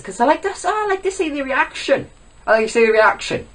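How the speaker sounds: high, silly put-on voice; mocking fan voice. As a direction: ridicule viewers in a squeaky pitch. Mimicking fans of face cams.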